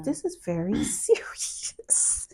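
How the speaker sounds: slow, hushed whisper; stunned whisper. Retold or imitated. Realizing the video is serious, slow whisper. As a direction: drop to a slow whisper as it sinks in. Reacting privately to a shocking video.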